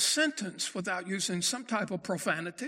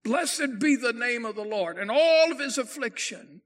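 ringing out higher and louder in triumph; triumphant; 4 semitones higher, 7 dB louder than usual. Retold or imitated. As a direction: proclaim it like a victory cry. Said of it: "what Job said so triumphantly".

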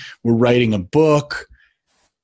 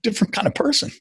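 amazed family; 7 semitones higher than usual. Retold or imitated. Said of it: "they're like".

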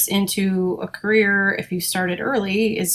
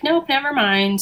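higher-pitched breezy brush-off; mock dismissal; 6 semitones higher than usual. Technician voicing people who quit the field, mock dismissive. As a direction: imitate people waving it all away.

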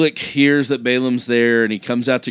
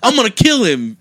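high, loud hammed-up fury; cartoonish rage, well above normal pitch; mock angry threat; 10 semitones higher, 6 dB louder than usual. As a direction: play him furious. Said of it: "Balaam's like".

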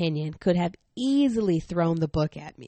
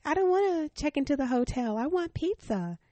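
put-on whiny child demand; 8 semitones higher than usual. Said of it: "Abigail is like".

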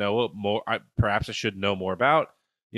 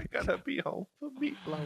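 scared squeaky voice; 13 semitones higher, 9 dB quieter than usual. Retold or imitated. Repeating the squeaky [name] joke.

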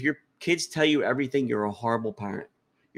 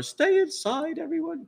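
put-on very high, squeaky try at an Irish accent; a shaky Irish accent that drifts off; mock Irish lady calling out; Irish accent attempt; slower than usual. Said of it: "[name]'s gonna have that Irish accent".

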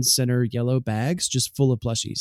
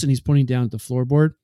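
normal talking voice

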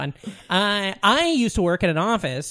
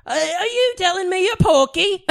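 high, slow Monty Python British voice; Monty Python British accent; 10 semitones higher than usual. Shown as a demonstration. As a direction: put on a high-pitched comic British accent. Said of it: "Any of your, uh, Monty Python lines".

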